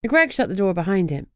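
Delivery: plain reading voice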